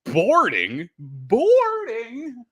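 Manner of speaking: scandalised commentator impression; mock disbelief. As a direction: echo it as an outraged commentator, voice rising. Mimicking incredulous commentators over a call.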